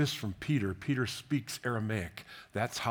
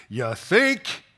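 loud, high-pitched incredulous quip; jokey, raised-voice disbelief; mock disbelief; 6 semitones higher, 8 dB louder than usual. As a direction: blurt it out louder and higher, incredulous. Mock reaction to the crowd's amazement.

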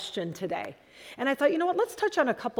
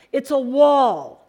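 amazed blind man; 2 semitones higher, 9 dB louder, slower than usual. Acted. Blind man exclaims on touching elephant.

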